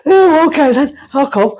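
high-pitched naive eagerness, laugh in the voice; mock your younger, gullible self agreeing; gullible, daft. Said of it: "like, a bit gullible".

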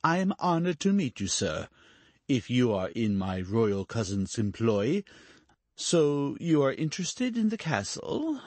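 a lightly voiced duke talking down; patronizing duke. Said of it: "with a patronizing air".

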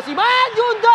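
high shout of the name; excited shout. Real, not acted. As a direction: call the name out loud. Chance in the box.

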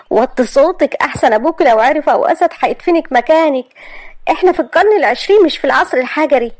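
strict, final mother; stern mother. Acted. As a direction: lay down the law to the daughter. Mother lays down the law.

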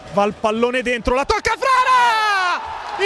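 very high, stretched shout of Frara; goal scream. Real, not acted. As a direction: scream it as the ball goes in. Late winning goal scrambled in.

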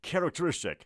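"characteristic" modelled clearly, starting "ke-rek"; teacher's model of "characteristic"; correct pronunciation. Shown as a demonstration. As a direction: say "characteristic" carefully with "ke-rek" first.

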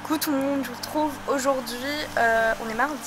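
talking outdoors in the wind; wind on mic. Real, not acted. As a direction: wind noise on the microphone. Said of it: "Désolée pour le vent".

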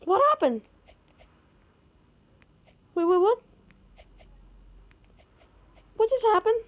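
rising disbelief at the glitch; high shocked questions; baffled what. Real, not acted. Confused by sudden game event.

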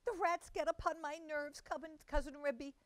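high, flustered mother-cat voice. A narrator's reading. Tabitha panics over her missing kittens, high and flustered. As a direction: stammer it out high and fretful. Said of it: "said Tabitha".

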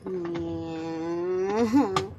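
low, wailing fake sob; mock crying. Sleepy daughter fake-crying about school, low wail. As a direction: put on a whimpering cry.

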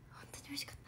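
whispered fond recollection; soft whisper. Real, not acted. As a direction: hush it like a secret treat. Recalling tasty meat bun. (しみじみささやく)

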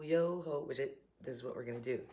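ordinary talk

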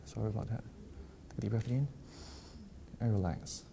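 soft, lulling hypnotist voice; hypnotic induction. Real, not acted. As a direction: ease them down softly, phrase by phrase. Hypnotist calming volunteer.

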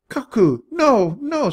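high, loud scolding of a pet. A narrator's reading. Countess pulls Koku off Jack's ear, scolding. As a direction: stop the naughty baboon at once.

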